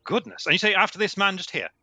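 hammed-up tweedy English toff; posh English gentleman. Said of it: "No, I'm English".

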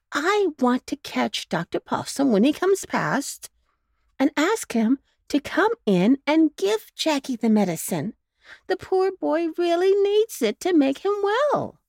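a fussing mother explaining her sick pup; mother dog voice, a touch anxious; worried mother dog. A narrator's reading. Missus Bow Wow explains.

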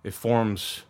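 low, measured scene narration; story narration. A narrator's reading. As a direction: set the scene like a game master.

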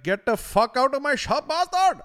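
high, very loud hammed-up bellow; mock-furious screech, full volume; angry shopkeeper yelling. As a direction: scream them out of your shop. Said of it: "you would go to go in the shop".

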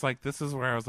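usual speaking voice

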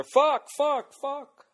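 high, loud swearing into the mic; shrieking the swears at the microphone in mock frustration; mock mic-check yelling. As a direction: bellow the swears like a deranged sound check. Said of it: "Go up there".

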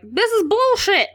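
high-pitched petulant protest; mock whining. Malfoy cries over Harry's luck, mock whine. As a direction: whine it like a sore loser.

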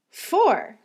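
high-pitched, incredulous exclamation; astonished cry. A narrator's reading. Martha astonished Mary doesn't know skipping ropes, crying out. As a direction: cry out the word in disbelief. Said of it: "cried out Martha".

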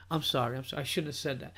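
a hushed mobster saying sorry; serious apology. Retold or imitated. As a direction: back down quietly, all serious. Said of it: "Paulie looks at him real serious".

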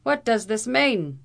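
high, loud, slowed Irish demand; vociferating. A narrator's reading. Captain furiously repeats the question at the lawyer. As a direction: hurl the question back in outrage. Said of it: "vociferated Captain O'Blunderbuss".

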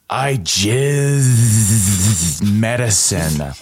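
"I jizz medicine" said very slow with a long drawn Z; dragged-out Z's. Shown as a demonstration. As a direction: draw out the Z in "jizz" like a snake. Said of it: "Re-, really hit those B's and P's".

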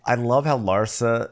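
ordinary talk

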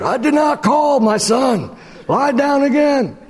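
put-on high, thin voice of a very old man; a frail priest over ninety, gently dismissing. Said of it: "It was the old man voice that got me there".

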